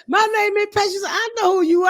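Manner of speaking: high, light voice for her; high voiced [name]. Voicing [name]'s reply.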